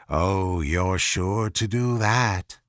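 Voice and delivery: low, slow and sly. A narrator's reading. The cat reassuring Alice, low and slow.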